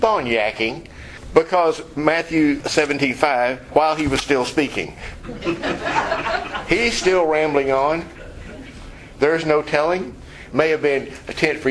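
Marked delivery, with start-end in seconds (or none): 5.0-6.6 a quick soft breathy chuckle; short breathy laugh. Real, not acted. Preacher chuckles at Peter rambling on, soft breathy laugh. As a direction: laugh under the breath, amused.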